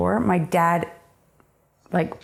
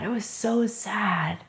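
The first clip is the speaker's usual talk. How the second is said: slow and softer, leaning on so; 3 semitones higher, 6 dB quieter, slower than usual. Real, not acted. Repeating the sad memory to the interviewer, slow and soft. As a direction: repeat it quietly, drawing it out.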